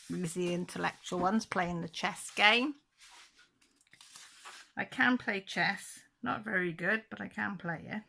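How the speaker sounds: usual speaking voice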